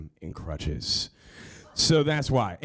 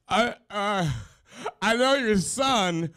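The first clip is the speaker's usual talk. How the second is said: choked-up, much higher and slow; nervous fan fighting back tears; tearful high voice; 11 semitones higher than usual. Retold or imitated. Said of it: "And I stop crying".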